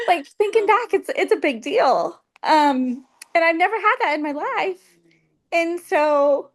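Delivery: choked up, fighting back tears; getting emotional. Real, not acted. Patient recalling her life changing, getting emotional. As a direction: voice lifting as emotion rises. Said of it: "gosh, I'm gonna get emotional".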